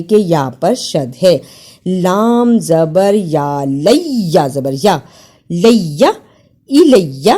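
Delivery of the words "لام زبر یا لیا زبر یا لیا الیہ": لیا with the ya doubled and pressed; shadda doubled. Shown as a demonstration. Teacher drills the doubled ya for students, firmly. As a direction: lean on the shadda in الیہ. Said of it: "شد کو صحیح ادا کرنا ہے".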